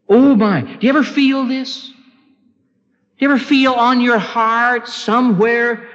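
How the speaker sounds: high, urgent sermon voice; impassioned preaching. Real, not acted. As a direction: press the congregation with high, burdened urgency. Preaches with full force.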